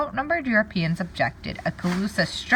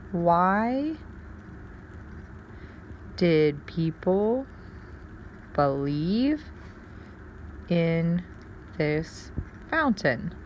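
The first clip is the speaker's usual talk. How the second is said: slow questioning; 3 dB quieter, much slower than usual. Shown as a demonstration. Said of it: "I have a question".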